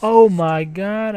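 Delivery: high, loud, slow exclamation; drawn-out amazement. Real, not acted. Player marvelling at a lucky move, amazed exclamation. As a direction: stretch it out in awe. Amazed at own play in game.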